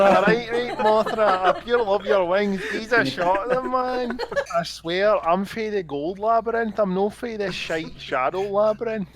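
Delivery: loud, high Glasgow ned voice; cocky Glasgow street lad; Glasgow street accent. Pretend. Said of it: "the Ned voice".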